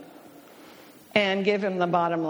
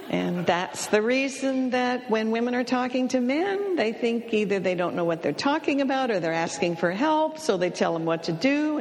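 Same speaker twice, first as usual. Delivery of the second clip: ordinary talk, nothing marked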